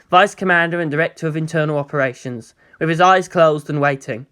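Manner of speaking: ordinary delivery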